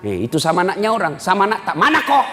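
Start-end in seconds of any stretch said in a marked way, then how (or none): 1.8-2.3 much higher and louder mock scolding; mock harsh mother. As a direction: snap at the child like a rough mother. Mother snapping at own child.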